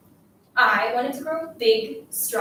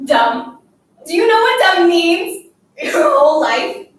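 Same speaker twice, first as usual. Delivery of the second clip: loud, rising exasperated demand; angry scolding; 3 semitones higher, 8 dB louder than usual. Acted. Girl berating the classmate who won't talk, angry scolding. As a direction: scold harder and louder, slowing on the last questions. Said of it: "I scolded".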